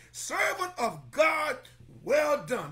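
slow, ringing loud praise; slow loud proclamation. Pretend. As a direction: proclaim it slowly and loudly. Preacher voicing God's praise.

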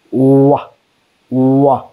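isolated "wa", lips rounded then opening; teacher sounding out "wa"; sound demonstration. Shown as a demonstration. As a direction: glide from a w into "wa". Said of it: "the sound of O letter is".

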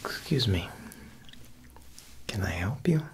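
ordinary delivery

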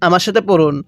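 usual speaking voice

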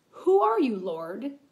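a startled, awed cry of "Who are you, Lord?"; Saul's awed cry of "Who are you, Lord?" to the blinding voice; trembling and astonished. Acted. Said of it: "he, trembling and astonished".

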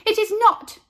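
high, loud denial; loud high protest; indignant shout. A narrator's reading. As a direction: snap back. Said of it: "said Carla".

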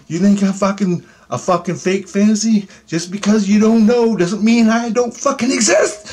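high, forceful accusing voice; indignant confrontation. Retold or imitated. Alien confronting the doubting sleeper, indignant and high. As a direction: build to a heated insistence on existing.

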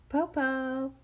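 high loud call; shouting. Acted. Shang shouting after the fallen wolf. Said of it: "Tao shouted".

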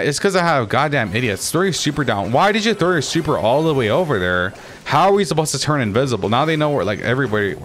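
real gamer frustration, pitch jumping up; exasperated scolding. Real, not acted. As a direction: scold the team for a blunder. Scolding teammates mid-match over misplay.

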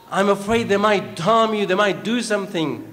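ordinary talk

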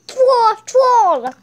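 high, loud battle shout; attack call. Pretend. Attack move called out in battle.

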